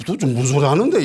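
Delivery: panicked protest, rising at the end; flustered outburst. Acted. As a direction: blurt it back as if caught out. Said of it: "이렇게 당황하면 나오는 소리죠".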